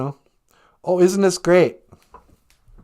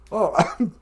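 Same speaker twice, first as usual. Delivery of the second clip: coughing, choking mid-word; 4 semitones higher than usual. Real, not acted. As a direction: choke and cough through it. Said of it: "I'm choking".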